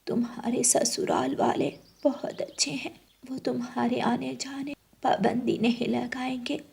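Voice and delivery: soft whispered motherly advice; mother's whisper. Acted. Mother whispering counsel to daughter before wedding. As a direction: hush it like a mother comforting her girl.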